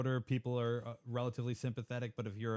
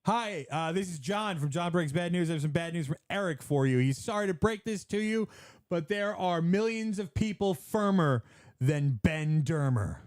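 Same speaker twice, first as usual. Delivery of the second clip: higher, chirpy fake caller; prank caller voice; 7 semitones higher, 5 dB louder than usual. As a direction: play a cheery messenger.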